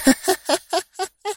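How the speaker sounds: high playful cackle; villainess's teasing laugh; mocking laugh. A narrator's reading. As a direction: toy with them in a cackle. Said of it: "ضحكة عالية عابثة".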